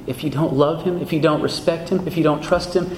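ordinary delivery

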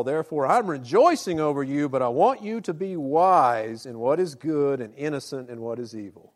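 loud fervent preaching. Acted. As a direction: proclaim it from the pulpit. Preaching the verse at full force.

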